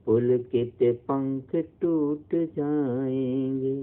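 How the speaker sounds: slow, drawn-out melodic poem recital; poem recitation. Acted. Teacher reciting the poem to her pupils, slow and melodic. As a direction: recite each line slowly, lingering like a chant.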